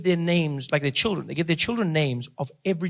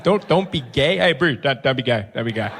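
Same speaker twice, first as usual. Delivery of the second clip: varied mock voices repeating; 3 semitones lower than usual. Comic repeating Ali's warning in silly voices. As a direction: toss the warning around.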